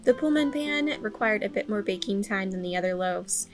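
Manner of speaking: ordinary talk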